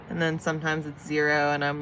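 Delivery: ordinary delivery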